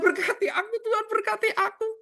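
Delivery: high, whiny mock plea for blessings; mock greedy begging prayer. Preacher mocks a selfish prayer: whiny high pleading.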